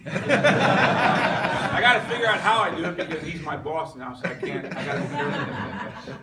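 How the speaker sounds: real laughter bubbling up mid-sentence; laughing. Real, not acted. Joking to the group about his new boss, laughing.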